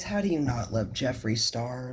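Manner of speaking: normal talking voice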